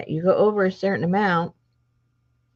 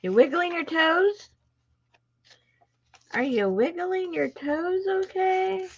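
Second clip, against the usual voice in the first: sweet high greeting; 12 semitones higher than usual. Real, not acted. Greeting viewers, baby-talk high.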